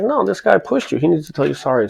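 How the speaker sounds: high-pitched, insistent protest; retold arguing. Man insisting to his boss on an apology, retold argument. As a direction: argue back, voice pitched well up. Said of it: "So I'm arguing with the guy".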